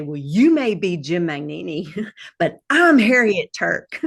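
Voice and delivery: lightly voiced defiant comeback, laughing at the end; a hint of sassy defiance, half laughing; defiant retort. Son retelling how Harriet stood up to Jim, a light defiant retort. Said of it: "And I heard her say".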